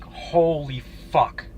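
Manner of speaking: drawn-out, very slow retold shock; slow, stunned retold swearing; stunned disbelief. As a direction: stretch both words out in disbelief.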